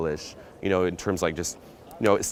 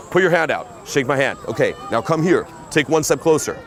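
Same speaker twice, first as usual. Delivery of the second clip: firm, raised step-by-step commands; ordering; 5 semitones higher, 4 dB louder than usual. Shown as a demonstration. Pickup coach baby-stepping a girl closer, ordering. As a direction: give each small order without asking. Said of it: "order her".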